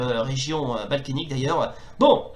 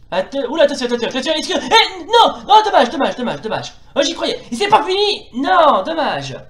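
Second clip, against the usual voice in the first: excited commentator; 10 semitones higher, 7 dB louder than usual. Acted. Near-goal during commentary.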